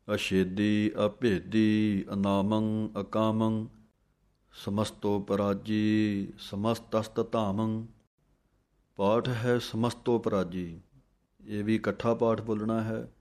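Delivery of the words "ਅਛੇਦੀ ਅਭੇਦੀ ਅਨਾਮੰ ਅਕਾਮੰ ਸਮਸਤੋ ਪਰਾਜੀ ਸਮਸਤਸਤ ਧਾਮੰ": slow, measured chant of verses; scripture recitation. Acted. Teacher recites Jaap Sahib for students, slow chant. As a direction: recite each line with reverence.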